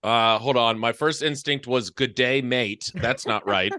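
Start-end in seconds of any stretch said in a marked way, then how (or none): 1.9-2.9 lower, badly faked Australian; accidental Australian accent. First attempt at Irish on request, coming out Australian. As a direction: try Irish, land in Australian. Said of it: "That's Australian".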